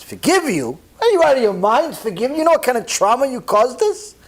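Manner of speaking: father's disbelieving outrage at the apology; outraged father. Retold or imitated. Father furious at the caller.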